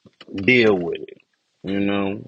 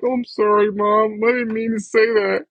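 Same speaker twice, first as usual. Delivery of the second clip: meek apology; 10 semitones higher than usual. Retold or imitated. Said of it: "I'm like".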